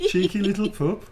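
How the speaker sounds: broad Brummie through giggles; original Brummie accent. Shown as a demonstration. Repeating the character line in laughing Brummie. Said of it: "that's my original accent".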